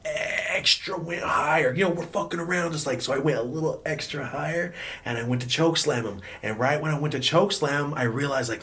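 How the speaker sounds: ordinary delivery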